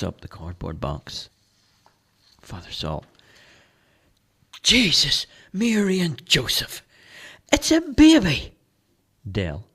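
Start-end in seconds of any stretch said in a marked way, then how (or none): 4.6-8.5 rising to much higher and louder; shocked priest. Acted. Priest opening the box to find a baby, shocked.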